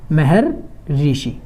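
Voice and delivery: Maharshi stretched out very slowly, each syllable drawn long; slow spelled-out demo. Shown as a demonstration. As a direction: drag out Maharshi so the class can write it. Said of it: "इसको हम कैसे लिखेंगे?".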